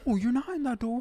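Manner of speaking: ordinary talk, nothing marked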